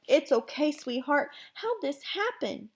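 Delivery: slow, tender voice on the edge of tears; near tears. Acted. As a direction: soothe him while about to cry. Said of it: "sounded like she was gonna be crying".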